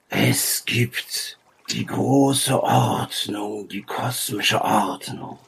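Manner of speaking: slow, partly whispered oracle; long pauses between stretched, breath-heavy phrases; cryptic ancient sage. Acted. Sage speaks in riddles to the travellers, slow and cryptic. Game master voices the sage.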